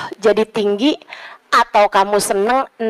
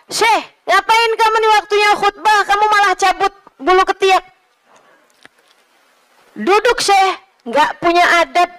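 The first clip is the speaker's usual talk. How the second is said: raised, higher voice scolding the sheikh; indignant telling-off, pitched up; scolding rebuke; 8 semitones higher than usual. Retold or imitated. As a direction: snap at him to stop.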